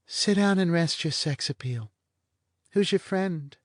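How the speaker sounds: a bored blonde toying with him; lazy blonde. Acted. Blonde teases Vanier about his sex appeal, languid and high.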